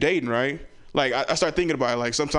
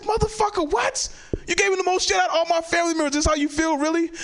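much higher, fast incredulous outburst; hammed-up shock at the hypocrite; outraged disbelief. Comedian to cousin who mocked him, high outraged disbelief.

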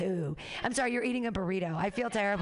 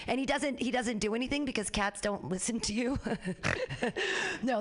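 ordinary talk, nothing marked

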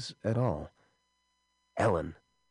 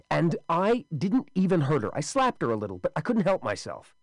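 agitated excuse; 8 semitones higher, 3 dB louder than usual. Acted. Wesley excuses himself.

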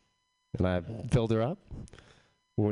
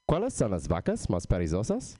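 Spanish delivered as a mock Texas accent; deadpan Spanish as the Texas punchline; Texas accent joke. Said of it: "a classic Texas joke in an authentic Texas accent".

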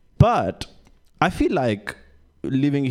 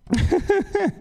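high giggle. Real, not acted. Laughs at own joke.

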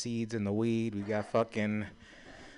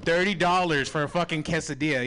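outraged; 5 semitones higher, 4 dB louder than usual. Real, not acted. Outraged at price.

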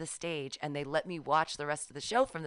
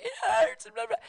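mock whimper; 6 dB louder than usual. Lying injured in truck.

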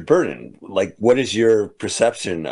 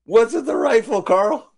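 high silly voice; 13 semitones higher than usual. Acted. Silly character voice, movie riff.

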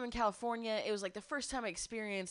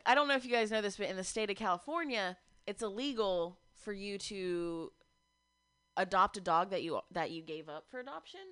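usual speaking voice

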